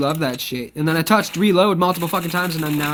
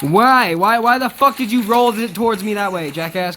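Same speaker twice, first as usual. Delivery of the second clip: louder, indignant yelling; angry shout; 3 semitones higher, 3 dB louder than usual. Real, not acted. Gamer yelling at a teammate who rolled it toward him, angry shout. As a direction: demand an answer from the teammate.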